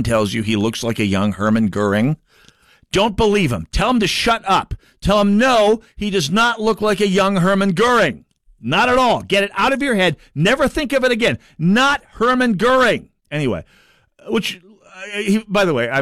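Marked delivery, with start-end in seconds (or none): 2.9-13.1 mock insistence. Host jokingly denying a Goering resemblance, mock-earnest. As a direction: protest far too much.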